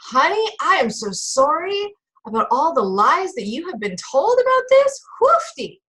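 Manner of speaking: high, hammed-up Southern charm; Southern drawl. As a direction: pour on a mock Southern drawl. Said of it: "I can start the Southern drawl in it too".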